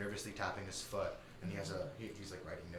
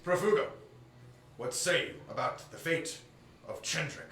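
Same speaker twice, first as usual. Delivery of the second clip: formal admiral; 8 semitones higher, 8 dB louder than usual. Acted. Admiral addresses council.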